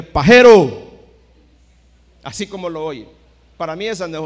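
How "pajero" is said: shouted insult at full force; angry shout. Retold or imitated. Son yells at the prophet from outside, furious shout. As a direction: yell it at the false prophet from outside. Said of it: "le grité".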